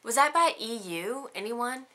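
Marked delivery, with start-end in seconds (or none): none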